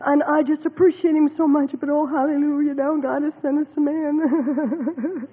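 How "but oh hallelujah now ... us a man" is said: ordinary talk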